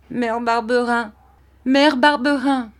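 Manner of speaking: put-on high child's cry for mother; desperate call. A narrator's reading. Boy calls for his foster mother, desperate cry. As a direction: call out high, pleading for rescue.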